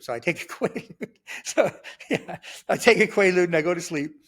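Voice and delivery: talking through a laugh; real laughing. Real, not acted. Storyteller amused by taking a Quaalude, laughing. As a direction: chuckle breaking into the words.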